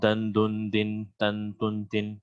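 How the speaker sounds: دن، دن، دن، تن، تن، تن chanted as nasal endings un, in, an; tanween sound demo. Shown as a demonstration. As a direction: sound out the nunation endings slowly. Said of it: "آپ کو یہ sound سنائی دے".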